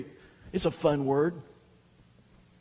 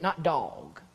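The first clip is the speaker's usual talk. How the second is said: usual speaking voice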